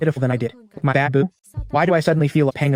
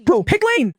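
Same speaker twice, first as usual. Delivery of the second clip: a sudden much higher exasperated yelp; exasperated aside; slower than usual. Acted. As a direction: blurt it out in disbelief.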